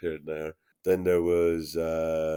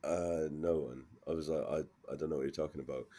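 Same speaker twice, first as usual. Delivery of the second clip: lower, softer, mumbled reply; retold sheepish denial; 4 semitones lower, 9 dB quieter than usual. New maker answering the head maker, sheepish denial. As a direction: play it down, quietly acting out your puzzled answer.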